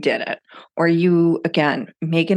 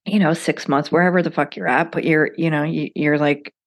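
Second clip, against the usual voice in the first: usual speaking voice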